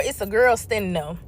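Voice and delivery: ordinary delivery, nothing marked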